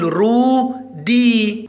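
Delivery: رودي slow, in two long syllables; sounded-out word. Shown as a demonstration. Teacher modelling the word for first graders, رودي slowly sounded out. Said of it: "نقول".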